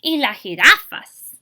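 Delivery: pointing out eagerly. A narrator's reading. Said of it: "Abuelito adds".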